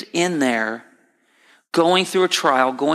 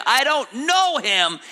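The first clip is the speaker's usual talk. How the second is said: high, drawn-out emphatic denial; swearing denial; 9 semitones higher than usual. Acted. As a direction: Peter protesting, pitched well up, slowly. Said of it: "he's in the process of cussing and swearing".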